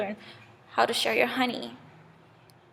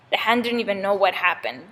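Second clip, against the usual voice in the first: plain reading voice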